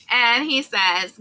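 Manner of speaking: ordinary delivery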